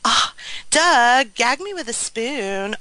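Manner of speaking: put-on bubbly Valley Girl drawl, high start; Valley Girl speak. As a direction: uptalk like an eighties Valley Girl. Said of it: "Valley Girl Speak".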